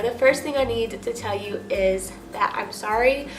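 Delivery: ordinary delivery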